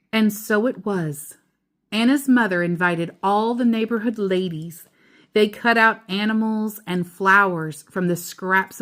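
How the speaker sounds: steady storybook read-aloud; reading to a child. A narrator's reading. Parent reading The Keeping Quilt to child, storybook voice. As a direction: read it gently to your child at bedtime.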